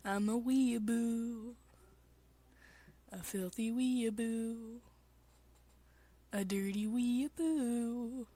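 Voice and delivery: slow, drawn-out self-mocking girl voice; lightly put-on cutesy voice. Streamer mocking herself in a slow put-on voice. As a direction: savor each insult slowly. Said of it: "voice acting stream".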